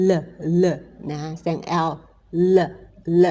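"l" as a short "luh" sound; isolated phonics "luh" for "l", repeated with gaps; letter sound. Shown as a demonstration. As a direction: say "l" as its sound, not its name.

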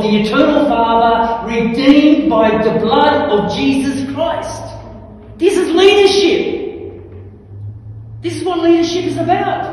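loud, high, building to a slow ringing cry; impassioned preacher at the peak of the sermon; full-force preaching. Real, not acted.

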